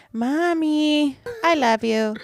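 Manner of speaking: put-on butter mommy up like a child; a lightly voiced sweet child; sweet kid. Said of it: "it'll be like".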